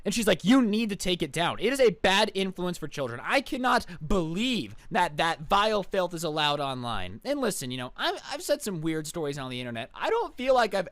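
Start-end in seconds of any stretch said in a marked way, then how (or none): none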